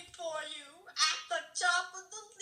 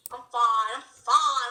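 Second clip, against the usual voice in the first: louder, insisting I'm fine; 3 semitones lower, 11 dB louder, slower than usual. Pretend. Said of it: "I'm like, oh, never mind".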